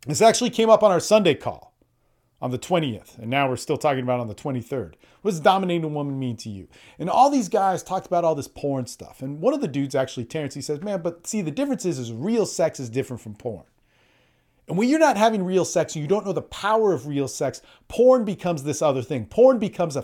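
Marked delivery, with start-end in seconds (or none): none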